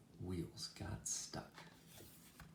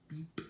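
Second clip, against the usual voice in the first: short, high horn beep; truck beep; 8 semitones higher than usual. A narrator's reading. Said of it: "and Blue said".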